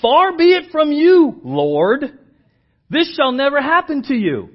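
loud, high rebuke; rebuking. Retold or imitated. Peter rebuking his Lord, loud high protest. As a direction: forbid it outright, loud and high. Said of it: "began to rebuke him, saying".

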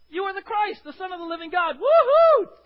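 loud, high triumphant declaration; exclaiming. Acted. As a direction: proclaim it like a breakthrough. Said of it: "Peter says".

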